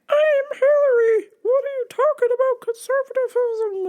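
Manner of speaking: squeaky, put-on mother voice; upset mom. Hillary-supporting mom upset at dinner, high mocking caricature. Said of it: "she is upset but doesn't wanna".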